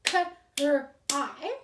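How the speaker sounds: "K-R-I" said as three separate clapped sounds; a teacher clapping out "K-R-I" sound by sound; sounding out cry. Shown as a demonstration. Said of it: "if I clap the word cry".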